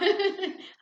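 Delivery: quick high embarrassed laugh; embarrassed giggle. Real, not acted. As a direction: giggle at the slip. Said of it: "I'm mixing up my words".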